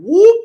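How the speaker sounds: sharp high loud whoop; imitated whoosh. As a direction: sound of the snatch. Dog grabbed and slammed.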